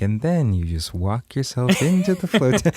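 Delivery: slow, gentle soothing voice; mock calming spa attendant. Shown as a demonstration. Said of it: "I've never felt normal just being like".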